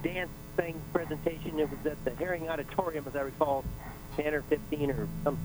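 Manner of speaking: faint, distant phone voice; phone held away. Real, not acted. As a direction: caller still far from the mouthpiece. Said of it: "Eh, barely".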